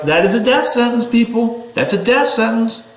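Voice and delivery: exasperated warning shout. Retold or imitated. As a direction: call out to the people, alarmed. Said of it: "but you're screaming".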